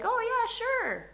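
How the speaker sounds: high-pitched, drawn-out, welcoming reply; friendly welcome. Retold or imitated. Strangers welcoming someone to their table, high and agreeable. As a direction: put on a high, slow, agreeable voice.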